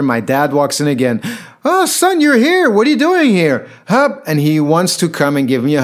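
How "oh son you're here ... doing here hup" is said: much higher and louder, gleeful greeting of a forgetful dad; delighted old father. Retold or imitated. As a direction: greet your son as if seeing him for the first time. Father greets son again.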